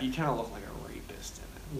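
breathy teasing whisper, no voice. Real, not acted. Whispers a joke to his friend about the creepy costume. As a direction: lean in and whisper the jab.

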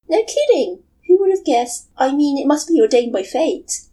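put-on young woman voice. Matsuri's voice held.

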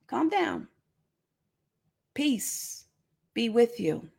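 calming Jesus. Acted. Jesus calming the startled group, very softly. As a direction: settle them down gently.

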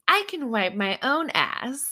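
lightly put-on movie character voice; movie-line impression. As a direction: mimic the line from a comedy film. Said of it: "like that Adam Sandler movie".

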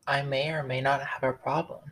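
ordinary delivery

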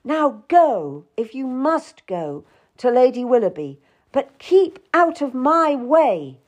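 high, emphatic warning; tense, angry urgency. A narrator's reading. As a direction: order her off sharply, stressing key words. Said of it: "Will tensed up".

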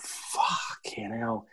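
drawn-out whispered awe; slow, whispery disbelief; hushed amazement. Retold or imitated. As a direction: let it out very slowly, almost all breath. Retells awed reaction to hearing song.

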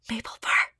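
hushed call into the dark den; soft whisper. Acted. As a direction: whisper the name softly. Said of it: "he whispered softly".

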